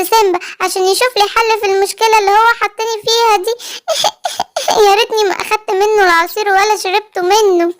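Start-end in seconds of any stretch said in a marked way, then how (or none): 3.6-4.8 a sudden high little giggle; girlish giggle; short giggle. Acted. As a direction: giggle at the mess she's in. Giggle after worried line.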